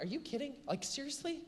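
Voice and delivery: high, disbelieving "are you kidding"; incredulous. Retold or imitated. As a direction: play up the mock disbelief. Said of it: "you're like".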